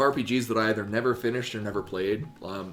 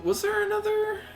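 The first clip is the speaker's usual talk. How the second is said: squeaky high character voice; high falsetto voice. Acted. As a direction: ask in a tiny falsetto.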